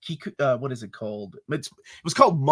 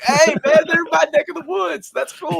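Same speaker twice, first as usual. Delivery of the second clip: much higher and louder, delighted; excited; 14 semitones higher, 7 dB louder than usual. Retold or imitated. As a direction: cheer like a kid seeing his home town. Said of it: "I'm like".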